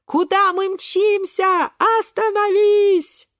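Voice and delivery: high, drawn-out shouting; alarmed yell. Acted. Friends begging Шпинатик to stop, high long yell. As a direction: cry out slowly and high to halt him.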